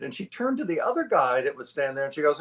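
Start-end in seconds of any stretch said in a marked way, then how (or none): none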